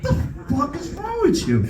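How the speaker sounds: squeaky mock outrage; high-pitched outrage. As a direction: screech it at him in mock horror. Son yelling at dad.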